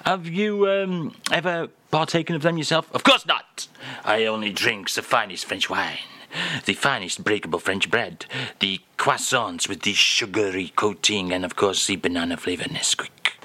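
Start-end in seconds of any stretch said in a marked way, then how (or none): 2.9-13.3 hammed-up French accent, lightly lower; mock French accent. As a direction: play the French crooner in a comic French accent. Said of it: "[name] is here".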